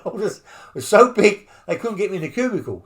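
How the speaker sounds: emphatic retelling. Real, not acted. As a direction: hammer the joke home. Repeats the punchline louder.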